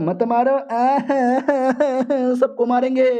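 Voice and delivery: gloating villain with a laugh; mocking villain laugh. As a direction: echo their begging, then laugh it off cruelly. Villain mocks begging victims.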